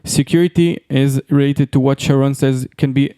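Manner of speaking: ordinary talk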